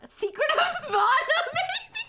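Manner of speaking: laughing through the words. Real, not acted. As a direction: laugh out the guess. Laughing at a trivia answer.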